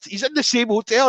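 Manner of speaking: a light, incredulous rise; surprised disbelief. Retold or imitated. Shocked he's in same hotel.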